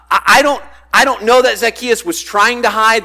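ordinary delivery

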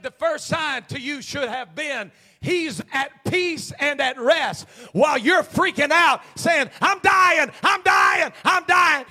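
building to high shouted preaching; emphatic repeat. Shown as a demonstration. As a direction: drive it home, rising into acted frantic cries. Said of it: "Can I say that one more time?".

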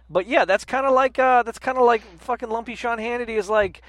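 ordinary talk, nothing marked

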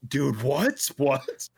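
a mock stunned double take; retold shock. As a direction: recoil at your own words, stunned. Shocked by what he wrote.

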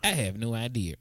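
put-on low, soft old lady voice; quavery little grandma. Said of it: "your grandma voice".

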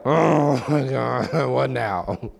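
slow weary groan of a phrase; exasperated parent. Retold or imitated. Tired parent hearing kids cry again, slow exasperation. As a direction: sigh it out, worn down. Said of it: "It's like".

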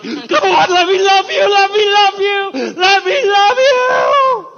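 high, wailing mock shout, drawn out; hammed-up insistent begging at full volume; persistent pleading. Preacher acts out God chasing a reluctant man, persistent pleading. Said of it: "he is really persistent. He's gonna be like".

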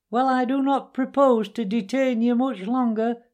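high-pitched after-dinner speechmaker; high squeaky weasel. A narrator's reading. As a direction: make a squeaky speech to a banquet. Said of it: "began in a high, squeaky voice".

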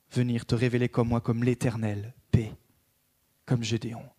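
a gentle murmured prayer. Acted. Voices a prayer to God.

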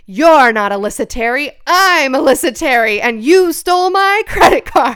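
loud, booming showdown line; triumphant confrontation. Retold or imitated. Narrator confronting the card thief at the door, loud and triumphant. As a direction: call her out loud and proud.

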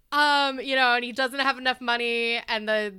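ordinary talk, nothing marked

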